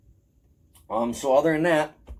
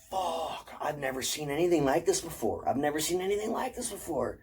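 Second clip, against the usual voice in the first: softer, higher re-enacted awe; retold hushed amazement; 4 semitones higher, 5 dB quieter than usual. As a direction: relive being stunned by the find, voice lifting.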